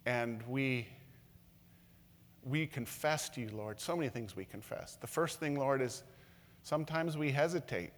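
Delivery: pastor praying gently over the room; prayer. Acted. Pastor leads closing prayer.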